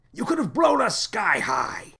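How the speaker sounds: high, alarmed full shout; panicked scolding yell. A narrator's reading. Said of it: "shouted the captain".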